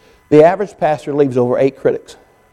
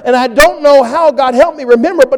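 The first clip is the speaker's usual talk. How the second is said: building preaching; 11 semitones higher, 5 dB louder than usual. Acted. Pastor preaching to congregation, building to full force. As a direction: lift it up into a preaching climax.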